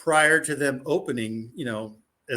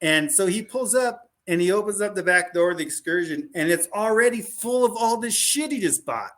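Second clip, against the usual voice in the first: ordinary delivery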